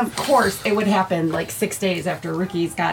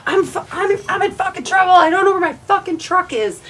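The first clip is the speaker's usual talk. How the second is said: higher mock-panicked friend; imitating panicked Ricky; 11 semitones higher than usual. Friend on the phone, mock panicked and higher. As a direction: play Ricky's frantic call. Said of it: "he goes".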